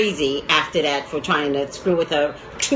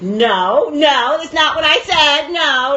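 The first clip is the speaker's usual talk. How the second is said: higher, drawn-out insistent no; retold indignant protest; 7 semitones higher, 3 dB louder, slower than usual. Recalls standing up for herself at that age, indignant protest. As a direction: push back hard in a raised voice. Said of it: "I was like".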